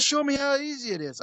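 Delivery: high, eager coaxing; imitated creep, pitched right up; South Park creep. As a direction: urge him on like a cartoon creep. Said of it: "That's how he talked".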